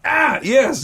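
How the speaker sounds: high-pitched retold yelp of pain; mock pained cry. Patient answering as doctor grabs toe, mock pained cry. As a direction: blurt it high, acting out sudden pain.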